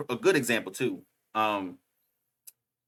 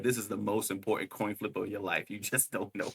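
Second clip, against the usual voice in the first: low, hushed retold threat; quiet menace; 4 semitones lower, 10 dB quieter than usual. Retelling a villain's coin-flip threat, quiet and low. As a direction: let it drop softly, deadly calm. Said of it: "looked at that man dead in his eyes and said".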